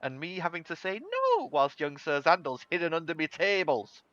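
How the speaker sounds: Polly, fond grumbling. Acted. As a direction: grumble fondly, with a high squeal on "No". Game master plays Polly complaining.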